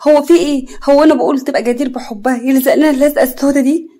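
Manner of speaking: loud, exasperated complaining; fed up. Acted. Mother complains to her daughter about the clingy suitor, fed up. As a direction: vent in exasperation, rising. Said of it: "نجوى بزهق".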